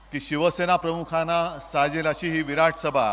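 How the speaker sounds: usual speaking voice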